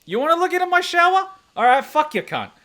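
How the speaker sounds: very high, loud mock fury; re-enacted indignant shouting; outraged retort. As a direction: yell back at a nosy neighbor. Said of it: "I'm like".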